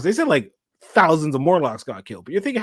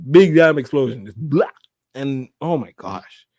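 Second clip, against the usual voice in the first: ordinary talk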